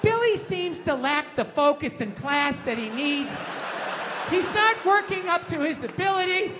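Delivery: mock a teacher reporting on a pupil; teacher's voice. Teacher voiced at a parent meeting.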